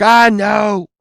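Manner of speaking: storyteller acting out a fed-up yell; exasperated order. Friend ordering his buddies into the car, exasperated shout.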